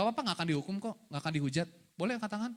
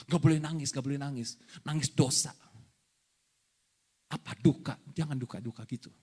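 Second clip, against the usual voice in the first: mock-stern prohibition in a lowered voice; stern pastor; 8 semitones lower than usual. Preacher mocking pastors who scold mourners, low mock-stern voice. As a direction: forbid it in a low imitation of a preacher. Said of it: "Saya bukan pendeta yang kayak gitu".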